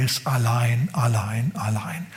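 whispered, dying away; fading whisper. Acted. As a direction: let allein fade to a bare whisper. Dramatic fade on loneliness.

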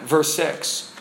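ordinary delivery

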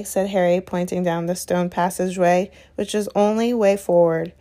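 usual speaking voice

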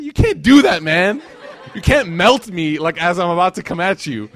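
loud, high-pitched complaint; mock exasperated protest. Father melted by his scared son, loud exasperated protest. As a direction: re-enact a helpless dad protesting at full volume.

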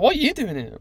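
"you" thrown back high and loud in "What are you doing here?"; mock-incredulous retort, punching "you" high; stress on YOU. Job hunter turns the question back on a stranger, high retort stressing "you".

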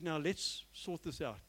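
lightly voiced Jesus, low and measured; calm voice of Jesus. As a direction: speak as Jesus would, unhurried and steady. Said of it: "is he said".

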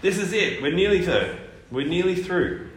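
usual speaking voice

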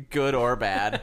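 real laughter; laughing. Real, not acted. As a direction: laugh as you say it. Laughing at dingo joke.

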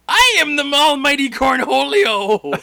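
high, loud cartoon yell; Cornholio impression. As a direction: shriek it like the cartoon bug-eyed Cornholio. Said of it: "the Cornholio impression".

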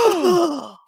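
a sharp, high gasp of an oh; shocked oh. Retold or imitated. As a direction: play the whole room being scandalised. Crowd reacts to an insult.

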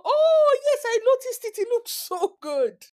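high, gushing, laughing "oh yes I noticed it"; gushing compliment. Pretend. Friend gushing over the eyebrow, high and gushing. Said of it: "There was no".

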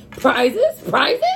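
high, loud confused calls; calling out. A narrator's reading. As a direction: call out the word in a confused way. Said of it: "calling out in a confused ways".

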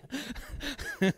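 a brief soft chuckle at his own story; a light chuckle after the beach line; short real laugh. Real, not acted. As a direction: let a quick laugh slip out.

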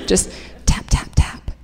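breathy whisper, tapping softly; whispered taps. Retold or imitated. Comedian whispers the gentle taps to the audience. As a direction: whisper each tap like a gentle knock. Soft knocking taps in story.

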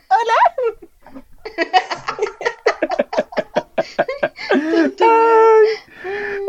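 giggly high hello; trying not to laugh; stifled giggles. Real, not acted. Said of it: "Este weón no se aguanta la risa".

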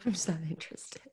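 a soft whispered aside; whispering. Real, not acted. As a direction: breathe it out as a whisper.

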